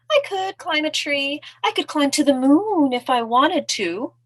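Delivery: high-pitched brag; high, swooping storybook boast; boasting mouse. Acted. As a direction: brag airily as a tiny mouse.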